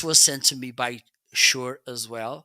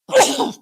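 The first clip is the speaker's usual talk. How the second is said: sudden explosive cough; sharp cough; 11 dB louder than usual. Real, not acted. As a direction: cough hard. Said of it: "I'm going to sneeze".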